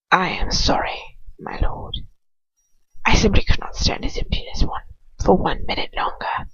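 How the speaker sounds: grovel in a hush; whispered apology. Acted. Lucius apologizing to Voldemort in a hush.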